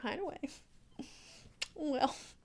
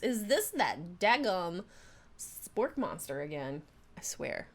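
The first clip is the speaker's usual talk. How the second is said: slow Southern drawl, falling at the end; slow Southern-accented grumble at the monster; Southern gentleman accent; slower than usual. Acted. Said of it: "I'm doing the Southern gentleman thing".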